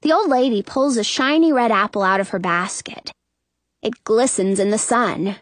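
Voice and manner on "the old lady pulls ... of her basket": usual speaking voice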